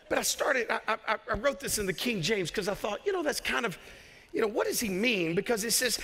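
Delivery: usual speaking voice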